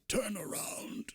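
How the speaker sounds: high raspy whisper; raspy old voice. Acted. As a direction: rasp the order like an old hermit. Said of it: "a raspy old voice".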